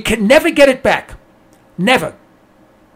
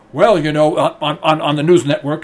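imitating a congressman, lower and hedging; a lower, fumbling politician voice on the news; mock congressman on air; 8 semitones lower than usual. Talking to a news network, a mock congressman hedging lower. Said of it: "gets on the air and says".

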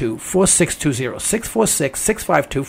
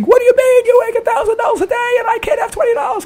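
screaming son; faster than usual. Retold or imitated. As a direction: shout it like an outraged broke son. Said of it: "he's screaming".

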